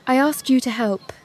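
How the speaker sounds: usual speaking voice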